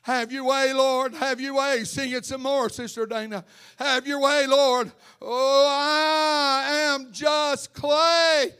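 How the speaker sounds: high, loud, slow preaching; full-force preaching. Real, not acted. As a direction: drive the scripture home at full force.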